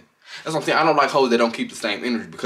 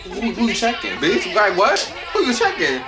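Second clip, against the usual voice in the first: laughing mock challenge; 9 semitones higher than usual. Replaying the quote, laughing.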